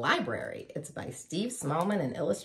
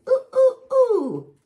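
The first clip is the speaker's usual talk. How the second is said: loud, high monkey oohs; monkey hoots; 10 dB louder, slower than usual. A narrator's reading. Monkey joins the animal band, loud high hoots. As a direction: hoot like an excited monkey. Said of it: "Monkey was the one who went".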